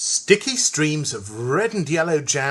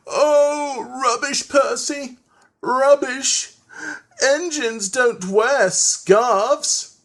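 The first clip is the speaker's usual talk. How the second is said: high, louder scornful engine voice, last line slow; Henry scoffing; 10 semitones higher, 4 dB louder, much slower than usual. A narrator's reading. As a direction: dismiss the little engine with a haughty scoff. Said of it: "and he said".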